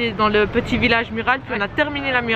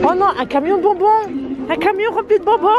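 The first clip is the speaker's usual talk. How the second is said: excited; 6 semitones higher than usual. Real, not acted. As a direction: gush over a candy truck. Excited at candy truck.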